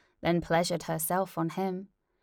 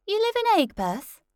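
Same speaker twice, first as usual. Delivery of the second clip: high chirpy presenter question; bright host question; 14 semitones higher, 6 dB louder than usual. Acted. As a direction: ask like a perky host. Host asks.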